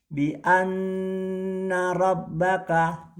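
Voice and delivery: Bi anna rabbaka, nn and bb held long, slow and high; held doubles. Shown as a demonstration. Teacher reciting for students, doubles held. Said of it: "jadi bacanya bi anna rabbaka".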